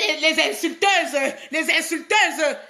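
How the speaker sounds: slow, stretched-out jeer; taunting, with lengthened endings; drawn-out taunt. Real, not acted. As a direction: drag out each word to mock them. Mocking those who come to insult.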